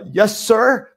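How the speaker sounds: high, meek "yes, sir" lifting at the end; polite, nervous reply. Retold or imitated. Rookie agent answering the yelling homeowner, polite and nervous. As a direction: imitate a flustered, well-mannered rookie. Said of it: "I was taught to be polite".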